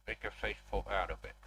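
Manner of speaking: talking around a pen in mouth; pen in teeth. Real, not acted. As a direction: speak with a pen between your teeth. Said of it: "I have a pen in my mouth".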